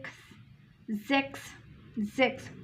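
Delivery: ज़ेक्स with z and ks; German sechs. Shown as a demonstration. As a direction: say ज़ेक्स starting with a z sound. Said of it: "ये तीनों मिलके खस". ("ज़" से शुरू होता ज़ेक्स)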